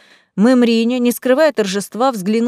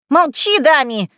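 higher, louder, slow shrieked order at her son; shrieking; 5 semitones higher, 3 dB louder than usual. A narrator's reading. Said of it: "взвизгнула Мэриня".

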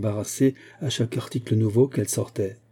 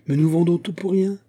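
cheerful cry; 7 semitones higher than usual. A narrator's reading. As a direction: toss it out gaily, like a delighted salesman. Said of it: "cria-t-il gaiement".

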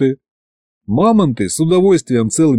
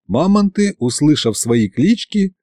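ordinary delivery

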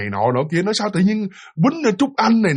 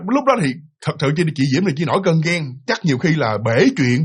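usual speaking voice

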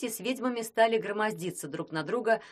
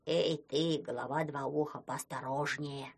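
low, slow grumbling warning; a lightly voiced gruff snowman; 5 semitones lower, 4 dB quieter than usual. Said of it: "сказал он".

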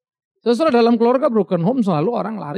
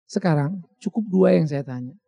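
ordinary talk, nothing marked

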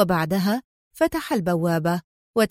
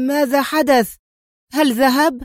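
higher, louder alarmed questions; startled cry; 5 semitones higher, 6 dB louder than usual. A narrator's reading. Man doused awake asking what happened, startled cry. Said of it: "وهب جالسا على الأريكة وهو يهتف".

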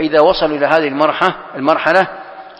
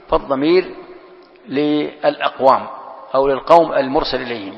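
normal talking voice

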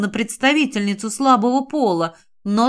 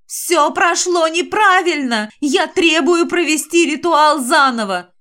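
hysterical shouting; 6 semitones higher than usual. A narrator's reading. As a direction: yell it like a spoiled brat losing it. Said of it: "истерично закричал голос".

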